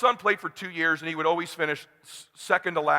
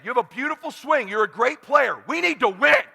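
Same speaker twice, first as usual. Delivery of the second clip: pitched-up, insistent encouragement; urgent pep talk; 8 semitones higher than usual. Retold or imitated. Dad urging his talented son to win, urgent.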